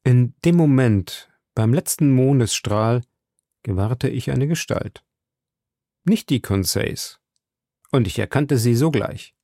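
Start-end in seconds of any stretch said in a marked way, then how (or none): none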